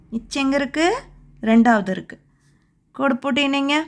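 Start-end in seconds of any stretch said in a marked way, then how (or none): none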